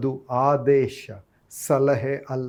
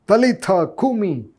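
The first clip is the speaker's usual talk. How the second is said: slow, higher, louder command; commanding call; 6 semitones higher, 6 dB louder, much slower than usual. Retold or imitated. ಯೇಸು ಸತ್ತ ಹುಡುಗಿಗೆ ಆಜ್ಞಾಪಿಸುತ್ತಾ, ನಿಧಾನ ಗಟ್ಟಿ ಕರೆ. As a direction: call the dead girl to rise. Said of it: "ಎಂಬ ಆಜ್ಞೆ".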